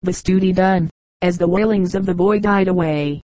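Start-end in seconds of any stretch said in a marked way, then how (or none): none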